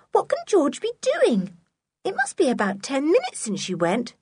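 put-on high, anxious girl's voice; Anne, puzzled and worried; young girl voice. A narrator's reading.

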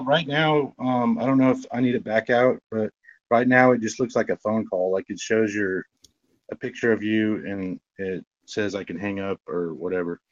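ordinary delivery

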